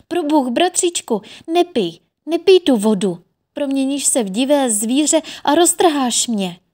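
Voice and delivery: high, loud frightened cry; terrified plea. A narrator's reading. Sister begs brother not to drink, terrified. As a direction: beg him in fear not to drink. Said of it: "zvolala zděšeně".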